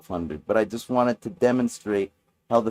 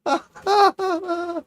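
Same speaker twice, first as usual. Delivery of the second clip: high stoned cackle; high laugh; 5 dB louder than usual. Real, not acted. Laughing hard.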